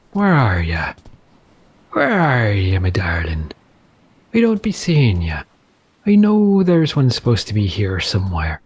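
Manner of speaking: pirate. Acted. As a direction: call for your quarry like an old pirate. Pirate voice searching for shark.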